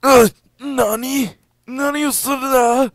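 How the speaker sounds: high, drawn-out anime-style gasp; hammed-up Japanese cartoon surprise; mock anime shock. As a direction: squeal it like a startled anime character.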